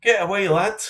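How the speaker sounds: a jokey English-Irish brogue telling a lad to get away; mock English-Irish accent. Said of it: "Don't know what accent that was".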